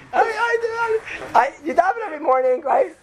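high, fast, teasing question; a mock quizmaster, pitched high; mock high quiz voice. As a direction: pipe it up like a game show host. Mocking asking someone.

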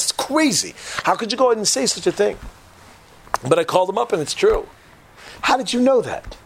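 high-pitched incredulous questioning; astonished disbelief. Acted. Acted writer, astonished at maestro.

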